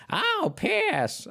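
very high squeaky cartoon-mouse voice; Mickey Mouse voice. Husband imitates his wife's giggly bedtime reply, squeaky Mickey Mouse voice. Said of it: "in, like, a very high-pitched, like, Mickey Mouse, like, feminine voice said".

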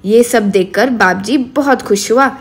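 ordinary delivery

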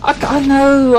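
hammed-up gasp then a mock sob "I know"; mock anguished gasp. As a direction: gasp as if wounded, half crying. Gasp, told to watch films.

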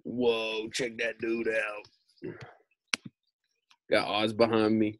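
ordinary delivery, nothing marked

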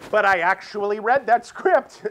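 slow, loud, high-pitched protest; big drawn-out exclamation; exasperated outburst. Real, not acted. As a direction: belt it out in comic despair. Comic exasperation at having read script.

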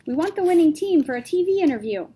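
loud, high call across the room. A narrator's reading. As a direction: shout it over the noise. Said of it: "someone else called out".

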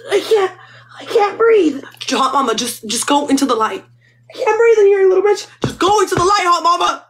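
high, loud, desperate cry for air; panicked gasp. Acted. Hot mama struggles to breathe, high panicked cry. Dying food gasps for air.

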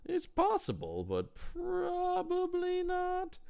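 high, slow, hedging put-on voice; mock doubtful doctor. Mocking the doctors' vague prognosis, high and slow. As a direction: drag it out like a shrugging doctor.

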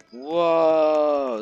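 amazed whoa. Real, not acted. Amazed at scene.

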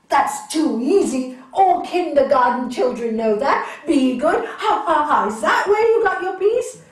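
loud, laughing derision; scornful scholar mocking the master, loudly. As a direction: sneer and laugh loudly at the idea. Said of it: "man said".

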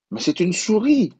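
put-on high, loud astonished child; a boy protesting in disbelief; child's surprise. A narrator's reading. L'enfant s'étonne. (surprise aiguë de l'enfant)

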